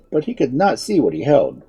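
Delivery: plain reading voice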